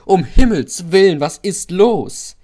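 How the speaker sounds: high, very loud alarmed screech; loud shrill outcry; screeching wagons. A narrator's reading. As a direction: shriek in panic like jolted wagons. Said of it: "kreischten die Güterwagen".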